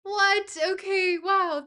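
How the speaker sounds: high, loud astonishment; amazed disbelief. Real, not acted. Streamer sees the goal was hit, amazed disbelief. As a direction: exclaim in real amazement.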